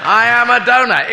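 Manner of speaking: loud, high mock proclamation; President Kennedy. President announces his blunder to the crowd, loud mock oratory. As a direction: belt it out as grand oratory. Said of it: "But he said".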